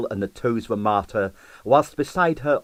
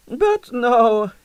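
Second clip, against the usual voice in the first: put-on Russian accent; 14 semitones higher, 5 dB louder than usual. A narrator's reading. Husband dismisses jealousy.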